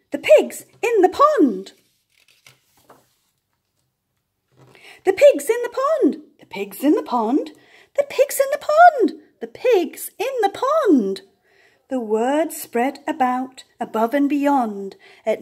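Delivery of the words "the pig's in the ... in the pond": much higher, loud alarm cries; excited shouting. Acted. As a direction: shout the news like excited birds. Geese spreading the alarm.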